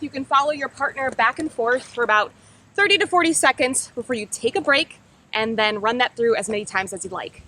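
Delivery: ordinary talk, nothing marked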